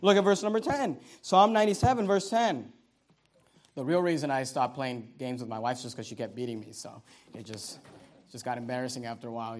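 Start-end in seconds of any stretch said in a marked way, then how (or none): 3.7-9.6 very low, soft mumbled aside; sheepish confession. Real, not acted. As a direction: drop right down for a joking admission. Joking confession about losing to wife.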